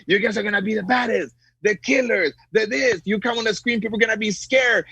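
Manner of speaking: high-pitched hyped-up speech, imitating the boss; pumped-up pep talk. Boss telling his actors they'll be the baddest killers, pumped up. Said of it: "I was pumped up when he was like".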